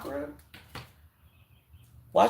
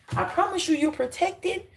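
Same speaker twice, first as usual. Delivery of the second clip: fervent high promise; 11 semitones higher than usual. Real, not acted.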